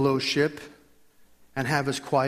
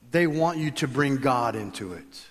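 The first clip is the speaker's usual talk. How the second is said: usual speaking voice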